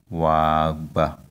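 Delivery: wagba, drawn out with no lift; no high tone. Shown as a demonstration. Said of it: "there's no high tone".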